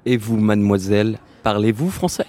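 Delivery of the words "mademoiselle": usual speaking voice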